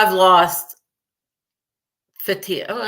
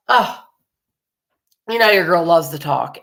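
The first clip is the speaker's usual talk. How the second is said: usual speaking voice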